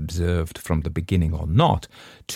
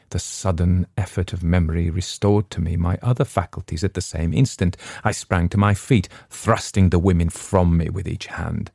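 usual speaking voice

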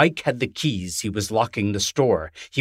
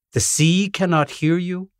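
ordinary delivery

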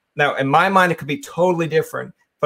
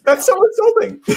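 high, loud squeal of fake outrage; hammed-up indignant squeak; mock offense; 6 dB louder than usual. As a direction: squeal as if insulted, joking.

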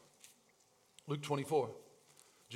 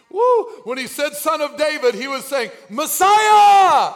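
shouted preaching, high and loud; preaching at full force; 13 semitones higher, 15 dB louder, slower than usual. Acted. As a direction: preach it out with fire.

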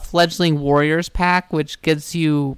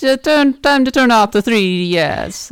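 console voice; 6 semitones higher, 5 dB louder than usual. Pretend. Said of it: "console shutting player off".

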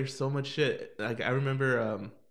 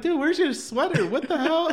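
shocked friend's voice; 12 semitones higher, 4 dB louder than usual. Retold or imitated. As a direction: play the friend who can't believe it. Friend Cindy voiced in story.

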